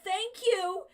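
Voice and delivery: loud, high teasing thanks; emphatic thanks. Real, not acted. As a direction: thank him with big mock gratitude. Mock grateful teasing of a chatter.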